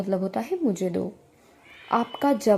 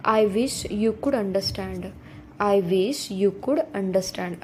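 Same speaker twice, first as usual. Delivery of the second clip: slow model reading; much slower than usual. Shown as a demonstration. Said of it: "teacher models the sentence slowly".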